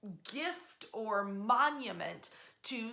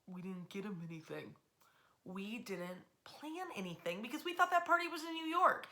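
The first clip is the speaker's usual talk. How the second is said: flustered city council; 4 dB quieter than usual. Retold or imitated. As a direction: play the caught-off-guard council members. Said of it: "the Detroit Common Council is like".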